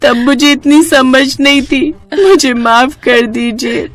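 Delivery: high, sobbing explanation; tearful apology. Acted. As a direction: plead innocently through tears. Weeping wife explains and begs forgiveness. (रोते हुए माफ़ी माँगना)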